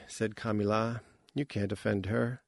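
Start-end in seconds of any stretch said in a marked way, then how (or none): none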